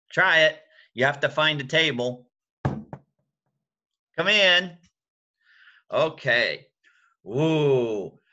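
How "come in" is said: loud knock on a door; knocking sound. Shown as a demonstration. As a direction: knock like a hand on wood. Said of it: "make a sound like a hand on a door".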